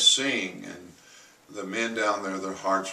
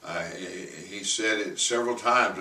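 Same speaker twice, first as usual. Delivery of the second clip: ordinary talk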